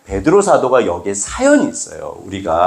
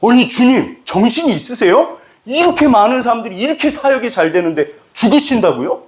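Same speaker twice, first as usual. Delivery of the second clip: rebuking; 11 semitones higher than usual. Retold or imitated. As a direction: tell him off in disbelief. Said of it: "꾸짖어요".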